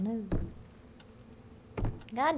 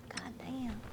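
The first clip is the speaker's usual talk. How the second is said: GOD DAMN trailing into a whisper; whispered curse; 9 dB quieter than usual. Real, not acted. Same curse repeated under the breath.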